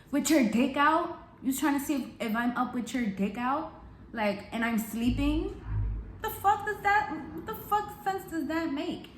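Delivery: scornful disbelief climbing in pitch; incredulous comeback. Retold or imitated. Retold incredulous comeback to his excuse.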